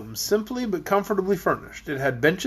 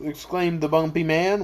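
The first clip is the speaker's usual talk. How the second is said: ordinary delivery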